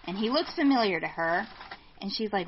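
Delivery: ordinary talk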